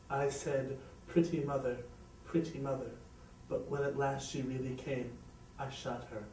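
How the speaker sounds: low, soft, fading confession; deep, hushed musing; reflective. A narrator's reading. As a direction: murmur it sadly, sinking away. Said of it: "he said, reflecting".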